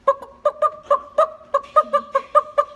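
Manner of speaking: very high, loud staccato clucking; chicken clucks. Acted. Chicken gets the librarian's attention, high loud clucking. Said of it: "looks at Mister Napier again and says".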